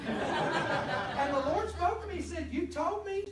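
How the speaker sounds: can barely get the words out for laughing; laughing through it. Real, not acted. Preacher quoting God to the congregation, laughing. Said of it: "busting out laughing".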